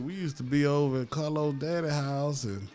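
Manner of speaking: normal talking voice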